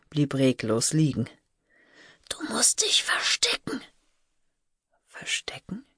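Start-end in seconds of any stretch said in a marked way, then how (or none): 2.3-3.8 high, urgent strained whisper; frightened whisper. A narrator's reading. Terrified sister warns child. 5.1-5.8 put-on very soft whispered child's echo; hushed question. A narrator's reading. Child whispers back, confused.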